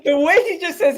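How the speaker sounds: high, loud, laughing disbelief. Real, not acted. Host mocking a caller's clip, laughing.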